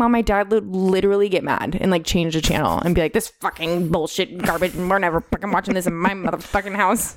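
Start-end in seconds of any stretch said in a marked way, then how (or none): 3.1-7.2 lightly voiced grumbling dad; imitating cursing dad. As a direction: do a mild impression of dad ranting at the TV. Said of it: "That's how he talks".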